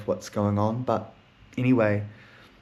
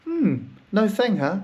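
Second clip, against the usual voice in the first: teasing; 10 semitones higher, slower than usual. Retold or imitated. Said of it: "She goes".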